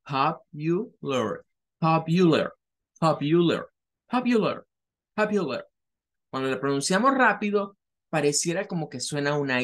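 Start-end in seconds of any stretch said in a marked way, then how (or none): 0.0-5.7 popular said pap-yu-ler, repeated; model pronunciation. Shown as a demonstration. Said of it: "la pronunciación debe de ser". (popular pronunciado papyuler, repetido)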